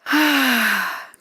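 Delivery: long exhaling sigh; big, drawn-out sigh of release; demonstrated sigh. Shown as a demonstration. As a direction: sigh out slowly and fully. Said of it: "What am I doing when I'm sighing?".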